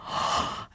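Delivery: quick unvoiced gasp of surprise; mock gasp. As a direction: catch your breath in disbelief. Shocked she agreed.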